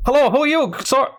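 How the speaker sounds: high-pitched call in a put-on accent; shouted accent. Fleeing dwarf hails the party, shouted accent. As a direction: call out to strangers in an improvised accent.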